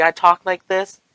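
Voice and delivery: high-pitched demo of own talking voice; talking "naturally". Shown as a demonstration. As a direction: show off your supposedly natural voice. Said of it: "When I just talk naturally".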